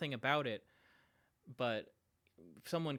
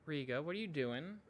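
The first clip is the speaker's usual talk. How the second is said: ordinary delivery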